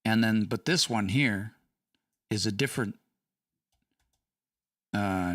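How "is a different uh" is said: ordinary talk, nothing marked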